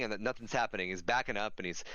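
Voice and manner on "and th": plain reading voice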